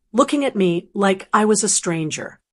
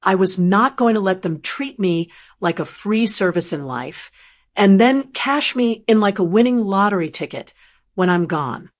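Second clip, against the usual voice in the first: ordinary delivery, nothing marked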